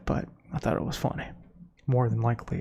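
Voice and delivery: ordinary delivery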